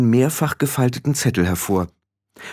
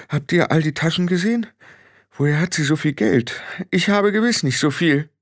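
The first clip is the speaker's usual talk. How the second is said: higher, fretting woman's voice; rattled Frau Grad; 8 semitones higher than usual. Acted. Frau Grad frets over Tiffany's shopping bags, agitated. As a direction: wonder aloud with mild agitation.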